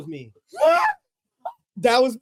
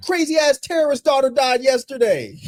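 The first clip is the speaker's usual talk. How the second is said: mock teacher; 12 semitones higher than usual. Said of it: "She was like".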